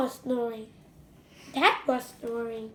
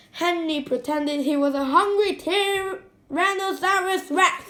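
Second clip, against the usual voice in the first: plain reading voice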